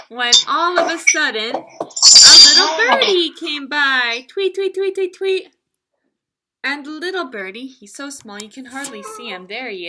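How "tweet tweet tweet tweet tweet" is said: high, chirpy tweets; bird chirps. Acted. Teacher imitating the little birdie for children, high chirping tweets.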